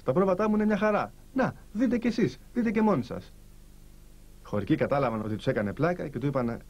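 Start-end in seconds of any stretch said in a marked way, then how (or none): none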